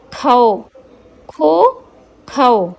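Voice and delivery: खो repeated clearly with round o; syllable drill. Shown as a demonstration. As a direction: drill खो three times. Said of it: "ओ की मात्रा". (खो को तीन बार साफ़ बोलें)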